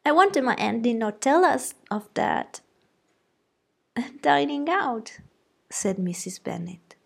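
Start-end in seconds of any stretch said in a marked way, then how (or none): 0.1-2.6 put-on high, eager young gossip; chattering excitedly to mamma; gossiping girl. A narrator's reading. Lydia voiced. 4.2-5.2 high-pitched fretting mother; flustered wife defending her girls; fussy mother. A narrator's reading. Mrs Bennet voiced.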